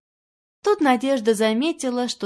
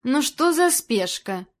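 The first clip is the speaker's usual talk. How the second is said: irritable, raised-pitch grumble; grumpy retort; 5 semitones higher, 3 dB louder, slower than usual. A narrator's reading. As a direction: grumble impatiently into the phone. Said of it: "буркнула она в трубку". (раздражённо буркнуть в трубку)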